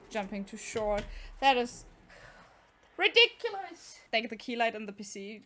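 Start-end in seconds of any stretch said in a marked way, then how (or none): 1.4-4.0 high, loud burst of exasperation; pitched-up, indignant outburst; exasperated. Real, not acted. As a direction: blurt it out in frustration. Exasperated at short jump.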